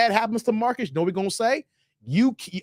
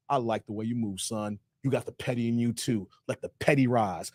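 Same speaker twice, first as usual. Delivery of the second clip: lowered sly Michael Jordan; 7 semitones lower, 6 dB quieter than usual. Retold or imitated. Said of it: "Michael's like".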